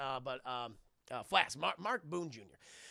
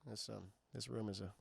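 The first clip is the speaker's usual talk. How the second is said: low, soft flat mock indifference; imitating an actor who isn't reacting at all; mock deadpan; 10 semitones lower, 8 dB quieter than usual. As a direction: drone it like a bored actor. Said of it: "not reacting at all to this fucking horrifying".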